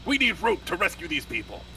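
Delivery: urgent demand. Acted. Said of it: "storm into the office".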